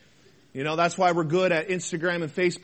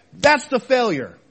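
louder, punched THAT'S THE FAILURE; emphatic verdict; 3 semitones higher, 7 dB louder than usual. Real, not acted. Preacher naming the real failure, emphatic verdict. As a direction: land "That's the failure" hard. Preacher hammers his point, then repeats.

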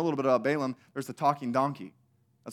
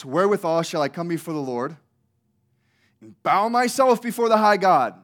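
sarcastic reading; 6 dB louder than usual. Shown as a demonstration. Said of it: "feel the sarcasm dripping out".

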